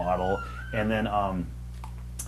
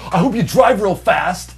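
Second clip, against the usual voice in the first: slow, loud, high-pitched parting jab; pissed off; 7 semitones higher, 6 dB louder, slower than usual. Retold or imitated. Colleague to departing guest, retold as pissed off. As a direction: imitate an angry coworker spacing out each word. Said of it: "he was so pissed off".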